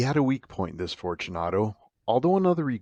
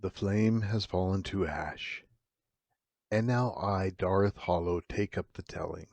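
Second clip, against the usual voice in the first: ominous host intro; 4 dB quieter than usual. Acted. Horror host welcoming listeners to the Morbid Hour, hushed and ominous. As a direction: draw the listener into the dark.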